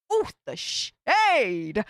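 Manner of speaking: slow, savouring "ooh, the shade!"; delighted shade. Real, not acted. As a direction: relish the drama. Gossip reaction to legal letter.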